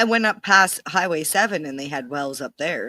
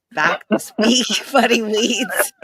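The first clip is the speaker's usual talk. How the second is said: laughing; 6 semitones higher, 4 dB louder, slower than usual. Real, not acted. Laughing at own slip.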